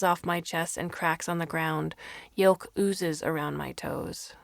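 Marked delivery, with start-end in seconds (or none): none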